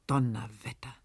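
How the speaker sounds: low breathless whisper of shock; hushed astonished whisper; stunned whisper. A narrator's reading. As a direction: breathe it out in disbelief. Bogner shocked by the sum.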